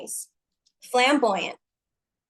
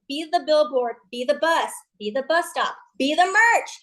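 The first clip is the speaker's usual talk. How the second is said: ordinary talk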